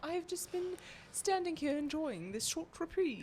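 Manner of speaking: mock Shakespeare. Teasing the storyteller for saying reprieve, mock Shakespeare. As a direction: gently put on a poetic air. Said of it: "All right, Shakespeare".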